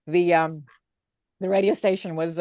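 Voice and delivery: usual speaking voice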